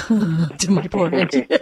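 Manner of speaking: slow words through laughter; laughing. Real, not acted. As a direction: chuckle while talking. Laughs at own joke.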